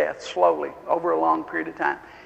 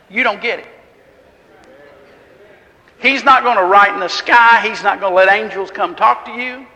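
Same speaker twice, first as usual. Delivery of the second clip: full-force preaching; 3 semitones higher, 5 dB louder than usual. Acted. Preacher urging the congregation to believe, fervent and loud.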